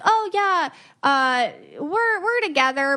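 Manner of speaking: breezy brush-off from a two-timer; a hint of a shady dater; mock shady partner.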